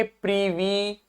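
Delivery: "Privi" with two long ee sounds; both syllables stressed. Shown as a demonstration. Teacher building the word for students, "Privi" stressed on both syllables. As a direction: give weight to each syllable of "Privi". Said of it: "हमें फिर से इस पे जोर दे देना है".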